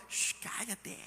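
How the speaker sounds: susurro sin voz, chistando; susurro para callar. Retold or imitated. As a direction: hazlo callar en voz baja. La gente calla a Bartimeo. (la multitud manda callar a Bartimeo en susurro)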